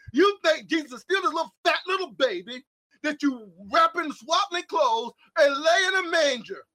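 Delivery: impassioned sermon voice; preaching at full force. Acted. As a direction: thunder it at the congregation like a pulpit preacher.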